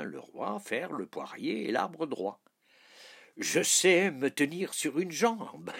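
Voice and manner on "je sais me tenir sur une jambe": high, loud boast of a king; exclaiming. A narrator's reading. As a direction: cry it out proudly, high and loud. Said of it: "s'écria le roi".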